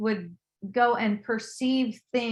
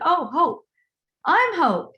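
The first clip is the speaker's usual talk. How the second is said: high, louder mock surprise; retold exclamation; 9 semitones higher, 5 dB louder than usual. Telling how I heard my name, high exclamation. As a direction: pipe up as if recognizing your own name.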